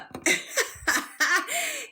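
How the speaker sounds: high run of giggles; real laugh. Real, not acted. Amused by the Pittsburgh detail, high laughter. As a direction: burst out laughing. Amused by her own story.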